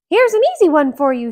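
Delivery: high, loud, fast mouse voice; Mickey voice. Acted. Mickey setting the giant a trick, fast. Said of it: "said Mickey".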